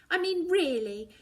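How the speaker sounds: loud demanding anger. A narrator's reading. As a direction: throw the question at her. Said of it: "I demand".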